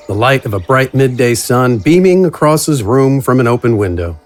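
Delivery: ordinary talk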